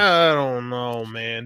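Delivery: long, loud, reluctant "I don't know"; drawn-out doubt. Retold or imitated. Disagreeing with a buddy's take, drawn-out doubt.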